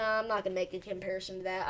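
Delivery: normal talking voice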